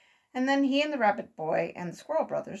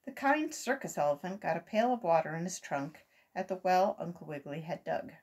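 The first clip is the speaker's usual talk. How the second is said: ordinary delivery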